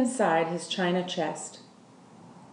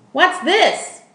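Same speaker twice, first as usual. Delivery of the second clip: a loud, sharp question, pitched higher; Lolly's loud challenge; 9 semitones higher, 7 dB louder than usual. Acted. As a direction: demand it like a bossy, loud woman. Lolly, loud woman, sees rabbit.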